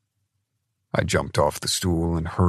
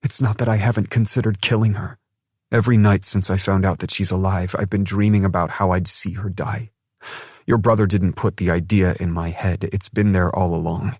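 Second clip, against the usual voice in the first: a hint of a bitter young woman; lightly voiced woman, dark confession; bitter woman's voice; 6 semitones higher, 6 dB louder than usual. A narrator's reading. Dinara's voice in dialogue.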